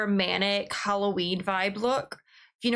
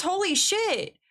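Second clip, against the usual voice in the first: amazed; 9 semitones higher, slower than usual. Real, not acted. Surprised at pack contents.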